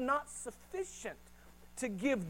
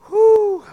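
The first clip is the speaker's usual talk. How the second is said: a loud exasperated whew; loud mock sigh; 11 semitones higher, 17 dB louder than usual. Praying person dreading prayer, loud exasperated whew. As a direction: blow out a big tired whew.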